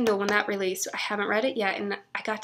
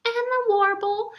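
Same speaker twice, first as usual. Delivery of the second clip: excited; 13 semitones higher, 5 dB louder, slower than usual. Real, not acted. Names the book.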